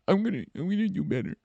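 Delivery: high, hurried mock-tearful voice; a little crying. Girlfriend promises to improve, mock-crying and squeaky. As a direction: whimper the promise like an embarrassed girlfriend. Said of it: "She's a little crying".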